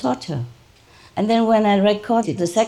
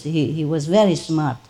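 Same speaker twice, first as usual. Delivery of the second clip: ordinary delivery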